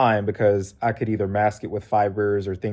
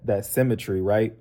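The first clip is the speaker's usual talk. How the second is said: normal talking voice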